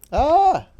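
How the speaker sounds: startled yelp. Real, not acted. Sudden outburst, then apologizes.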